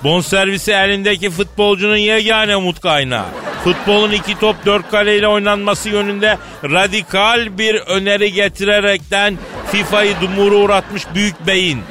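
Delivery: grand announcer intro. Acted. Host presenting the chairman to the studio, grand announcer build-up. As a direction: hype the guest like a ring announcer, swelling as you go.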